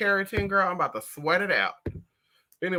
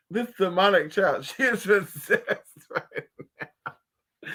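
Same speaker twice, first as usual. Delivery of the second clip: words dissolving into laughter; laughing through words; slower than usual. Real, not acted. Telling viewers about the child, trailing into laughter. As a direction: laugh it out until it runs out of voice.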